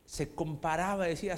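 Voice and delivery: ordinary delivery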